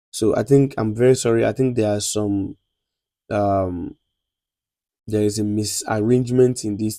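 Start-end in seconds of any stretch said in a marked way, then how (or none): none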